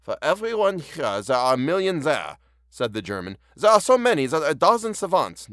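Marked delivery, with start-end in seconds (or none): 0.0-2.4 put-on higher-pitched, clumsy German accent; bad German accent. Reader voicing the story's German, badly done German accent. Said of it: "nope, see, can't do German". 3.6-5.5 put-on loud, higher-pitched failed German accent; bad German accent. Reader attempting the German's line in a bad German accent. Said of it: "nope, see, can't do German".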